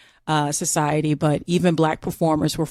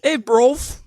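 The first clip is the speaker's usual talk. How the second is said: playful mock British call, slightly higher; modern British slang accent; 4 semitones higher than usual. Entertaining her co-host, mock modern British accent. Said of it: "that's the modern equivalent of".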